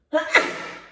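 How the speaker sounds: sudden sharp sneeze; a real sneeze bursting out. Real, not acted. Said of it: "Désolée, je vais éternuer". (éternuement soudain)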